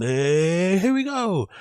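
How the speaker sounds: high, drawn-out goofy wind-up call; mock his run-up with a rising, swooping cry; mocking run-up imitation. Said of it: "he loves doing that fucking".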